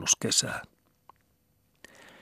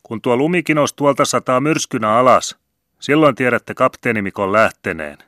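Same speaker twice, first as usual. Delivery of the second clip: high, loud call back from the ship; shouted reply; 6 semitones higher, 4 dB louder than usual. A narrator's reading. Captain shouting his farewell to the crowd: high loud call. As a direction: yell it back across the harbour. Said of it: "Kapteeni Mikko huusi vastaan".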